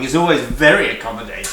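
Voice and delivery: usual speaking voice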